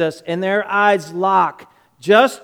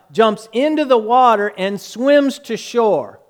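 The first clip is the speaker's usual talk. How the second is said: ordinary delivery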